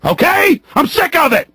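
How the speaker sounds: high, exasperated burst of anger; fed-up outburst. Real, not acted. Host sick of the system, fed-up outburst. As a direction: let your pitch leap, truly fed up.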